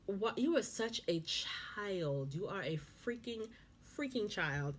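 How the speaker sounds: slow, disbelieving scold; exasperated scolding. Acted. Host scolding the childish husband, slow and exasperated. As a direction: drag it out in disbelief.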